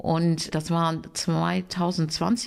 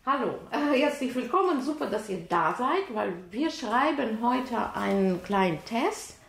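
higher, bright-toned greeting to the students; classroom teacher voice; 5 semitones higher than usual. Real, not acted. Teacher welcoming her Ukrainian students to a test, upbeat classroom voice.

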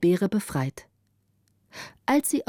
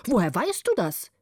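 angry question; 3 semitones higher than usual. A narrator's reading. Old man challenging the girl, mildly angry. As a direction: demand it with a touch of storybook anger. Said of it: "rief der Alte zornig".

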